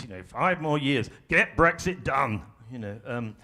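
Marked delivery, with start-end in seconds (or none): 0.2-2.4 "Five more years. Get Brexit done" in punched threes; strong beats. Shown as a demonstration. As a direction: hit each word of "Get Brexit done" hard and slow. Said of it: "They're kind of strong beats".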